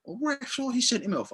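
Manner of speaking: high, whiny mock protest. Football fan imitating fans berating the referee, high whine. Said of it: "what we thought".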